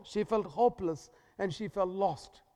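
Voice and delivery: normal talking voice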